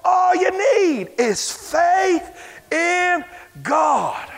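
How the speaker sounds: loud, drawn-out preacher's shout; hammed-up revival preacher; Schambach quote. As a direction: boom it like an old revivalist. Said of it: "R W Schambach said".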